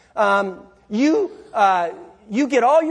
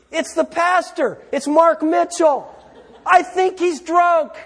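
higher, louder, slow half-shout to the wife; loud reply; 7 semitones higher, 3 dB louder than usual. Acted. Husband telling his wife who is pounding on the door, shouting. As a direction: call back loudly, exasperated. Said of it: "you say".

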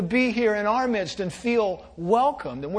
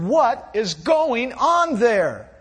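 louder, higher and slow alarmed boss; alarmed near-shout; 4 semitones higher, 4 dB louder, slower than usual. A narrator's reading. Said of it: "the boss nearly shouted".

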